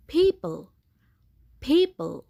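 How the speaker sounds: people, p with a light puff; light aspirated p. Shown as a demonstration. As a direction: breathe out on the p in people. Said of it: "পিটা হালকা ফয়ের মতো উচ্চারিত হবে".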